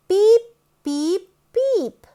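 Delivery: high, loud beep beep beep; horn beep voice. Acted. Storyteller sounding the truck's horn at the end. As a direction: honk like a toy truck horn.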